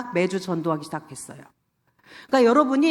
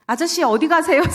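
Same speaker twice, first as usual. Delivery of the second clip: higher, louder call to a passerby; 6 semitones higher, 3 dB louder than usual. Retold or imitated. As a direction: call out brightly to a stranger on the street. Calls out from car window.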